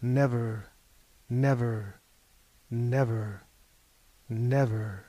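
low, slow, fading nevers; raven's refrain. A narrator's reading. The captain's final answer to Mahogany, low and fading. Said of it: "Quoth the captain".

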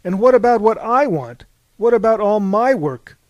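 loud, high-pitched demands; aggrieved, insistent questioning; indignant protest. A narrator's reading. Aphrodite protesting in a quarrel.

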